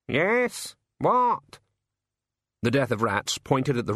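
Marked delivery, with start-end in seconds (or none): 0.1-1.6 higher, slow, aggrieved bird voice; a put-upon bird griping; grumbling raven. Acted. Raven complaining about the bird table to the rat, aggrieved.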